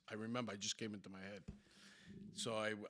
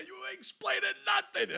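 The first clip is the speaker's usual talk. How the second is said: high, loud mock police rebuff; yelling cop; 9 dB louder than usual. Cop cutting off the driver's excuse, yelling. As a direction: shut him down with an imitated yell. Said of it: "he's t-, yelling, screaming at them".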